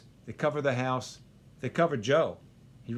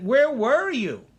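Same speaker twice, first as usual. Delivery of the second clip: slow, much higher, louder accusation; aggrieved lament; 10 semitones higher, 8 dB louder, slower than usual. Retold or imitated. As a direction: demand an answer, drawn out. Joe confronting God.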